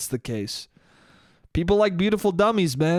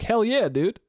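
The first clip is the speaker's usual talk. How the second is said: higher, pumped cheer; excited; 6 semitones higher than usual. Retold or imitated. Dater who finally clicked with someone, pumped cheer. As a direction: celebrate a good date. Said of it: "you're like".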